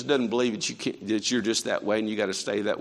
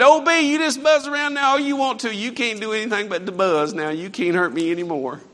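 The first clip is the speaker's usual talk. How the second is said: taunting the bee; 9 semitones higher, 7 dB louder than usual. Retold or imitated. Father taunting the stingless bee.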